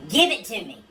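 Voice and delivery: a hint of a scream, holding back; mild start of a scream. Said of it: "A wife screams".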